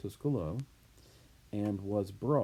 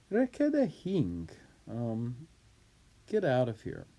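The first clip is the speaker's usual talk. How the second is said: loud, higher-pitched peremptory dismissal; rude, arrogant; 5 semitones higher, 5 dB louder than usual. Acted. Julius dismissing his slave doorkeeper, rude and arrogant. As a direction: order them off imperiously. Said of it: "He's typically rude and arrogant here".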